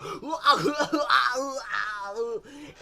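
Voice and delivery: hammed-up hooting laugh; goofy drawn-out whooping laugh. Juarez celebrating with Ignacio, exaggerated hooting laugh. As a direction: let out a long, silly whoop. Acted presidential congratulation ends.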